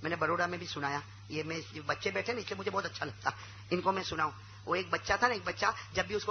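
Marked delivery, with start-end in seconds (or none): none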